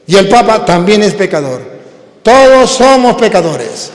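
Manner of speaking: loud, slow, high proclaiming; impassioned pulpit delivery; preaching at full force. Acted. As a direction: drive the verse home to the hall. Preacher rises again.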